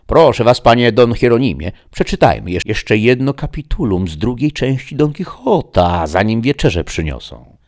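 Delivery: loud, high, animated request; gentleman guest, urging a friend. Acted. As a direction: urge a companion brightly and loudly. Guest overheard in next room.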